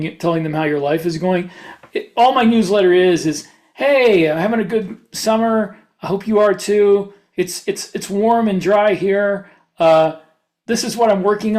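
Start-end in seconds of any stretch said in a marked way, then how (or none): none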